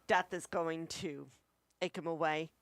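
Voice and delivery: ordinary delivery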